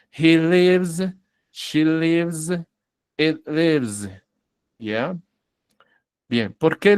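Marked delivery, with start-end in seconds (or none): 0.1-4.1 "lives" each time with a long voiced z; careful drill, buzzing the end of "lives"; final S as Z. Shown as a demonstration. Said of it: "si lo pronunció bien es con Z". ("lives" con Z sonora al final, pronunciado con cuidado)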